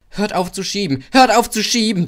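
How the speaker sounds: high, loud desperate plea; frantic little engine; hissing plea. A narrator's reading. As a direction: beg them to stop pushing. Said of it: "zischte er".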